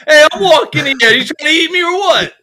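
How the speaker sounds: put-on loud, high New York wiseguy accent; brash New York tough guy; hammed-up New York accent. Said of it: "That's my deal New York accent".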